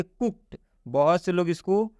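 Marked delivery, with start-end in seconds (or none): 0.0-0.6 कुक्ड as "kukt", higher pitched. Shown as a demonstration. Teacher repeats the answer for learners, कुक्ड with a T ending. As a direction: end कुक्ड on a crisp T sound. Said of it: "क्या पढ़ेंगे".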